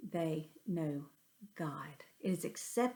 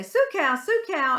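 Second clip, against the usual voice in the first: higher, louder cattle call; calling the cows; 8 semitones higher, 8 dB louder than usual. Retold or imitated. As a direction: summon the cows to the gate. Dad calling cows off highway.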